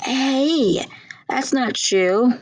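high, drawn-out objection; playful protest. Acted. Sister objecting to her brother's remark, high protest.

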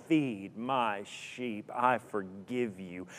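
very slow, soft words of forgiveness; hushed forgiveness. Acted. Preacher echoing Jesus' forgiveness to the congregation, slow and hushed. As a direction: let each word land gently.

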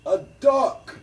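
high, loud movie-quote outburst; mock scream. Film fan quotes King Arthur's outburst, mock scream. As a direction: shout it out high and sudden. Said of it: "King Arthur screams out".

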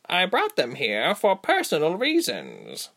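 high, slow creature voice; firm interruption. A narrator's reading. Female creature interrupting the tabby, firm. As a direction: cut in, drawing the words out firmly. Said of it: "she interrupted".

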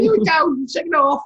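high, loud mock-Cockney cry; Cockney geezer. Co-host hamming up the second-half intro, loud Cockney geezer. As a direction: holler it like a hammed-up Cockney geezer. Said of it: "Cockney geezer".

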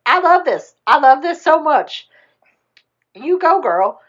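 high, loud gushing; thrilled cheering. Retold or imitated. Ray hears Naveen will marry her, high thrilled cheer. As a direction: cheer it on with hammed-up delight.

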